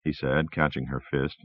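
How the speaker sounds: ordinary delivery, nothing marked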